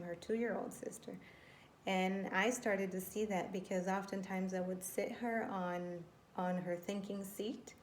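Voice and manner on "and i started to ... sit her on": usual speaking voice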